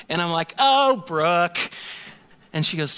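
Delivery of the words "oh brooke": exasperated groan of her name. Retold or imitated. Exasperated reply to wife.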